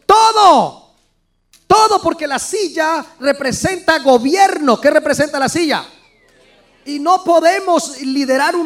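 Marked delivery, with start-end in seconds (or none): 0.0-4.8 emphatic shout. Real, not acted. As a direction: throw the answer out to the room. Preacher answers the objection. (grito enfático)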